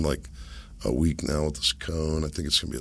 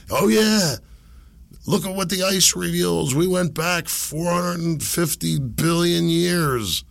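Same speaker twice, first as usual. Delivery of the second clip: high, put-on know-it-all voice; ranting science zealot; 10 semitones higher, slower than usual. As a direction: imitate a zealot unloading, pitched way up. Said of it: "This dude just unloads".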